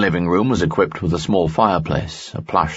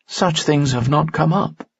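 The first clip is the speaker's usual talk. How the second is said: higher young woman's voice, lightly voiced; worried young woman, pitched up; Dina's voice; 10 semitones higher than usual. Dina speaks in story.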